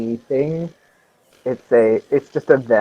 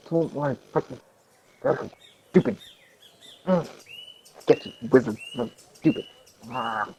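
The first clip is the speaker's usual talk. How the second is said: grumbling under breath; 2 semitones lower, 10 dB quieter than usual. Real, not acted. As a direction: mutter sulkily to yourself. Grumbles while forced to roll.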